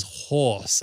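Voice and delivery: ordinary talk, nothing marked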